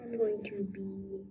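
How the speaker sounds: slow hushed whisper. Real, not acted. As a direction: breathe it out slowly, no voice. Quiet pick in game video.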